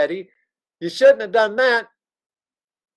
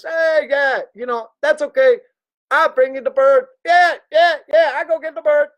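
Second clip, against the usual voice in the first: put-on eager kid shout; 8 semitones higher, 4 dB louder than usual. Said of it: "and Teddy says".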